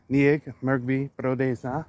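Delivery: "Nieg Mergbi Brodes Na" stretched out slowly; slow practice repeat. Shown as a demonstration. Learner repeating the Khmer question slowly to practice. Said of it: "I'm sure I'm not even saying right".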